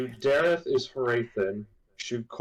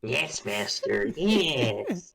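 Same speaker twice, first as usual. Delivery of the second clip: hunchback assistant; much slower than usual. Acted. Joining the fawning servant bit.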